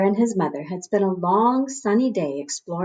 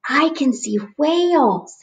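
little bear voice; 6 semitones higher than usual. A narrator's reading.

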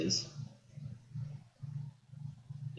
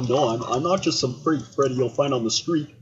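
ordinary delivery

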